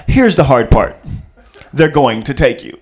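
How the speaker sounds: low, loud, commanding; father on the phone, deep and loud; stern movie dad. Retold or imitated. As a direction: deliver bad news coldly and firmly. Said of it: "They want, uh".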